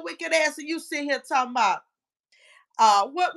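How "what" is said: normal talking voice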